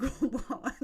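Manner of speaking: high, laughing "what"; retold disbelief. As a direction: exclaim in amused astonishment. Reacting to cat not being dead.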